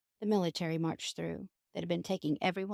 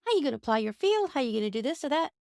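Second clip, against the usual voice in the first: higher, a hint of a worried neighbor; fretting neighbor; 6 semitones higher than usual. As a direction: lightly play the alarmed neighbor. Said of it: "And he goes".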